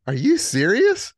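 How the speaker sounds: high, loud, drawn-out disbelief; big mock-astonished question; incredulous roommate. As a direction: imitate a stunned friend, rising at the end. Roommate's acted incredulous reaction.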